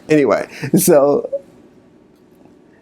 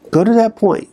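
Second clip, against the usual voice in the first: plain; 8 semitones higher than usual. Shown as a demonstration.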